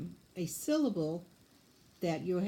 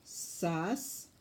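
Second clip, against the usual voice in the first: usual speaking voice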